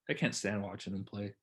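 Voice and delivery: normal talking voice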